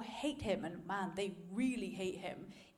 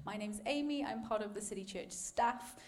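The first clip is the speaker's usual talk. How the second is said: ordinary delivery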